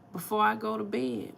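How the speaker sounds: ordinary talk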